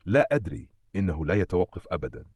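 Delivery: usual speaking voice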